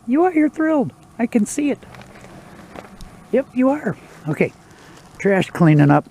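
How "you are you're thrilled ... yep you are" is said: squeaky high teasing voice to the dog; high pet talk. Real, not acted. Owner teasing her grumpy dog, high sing-song. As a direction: tease the dog in a high coo.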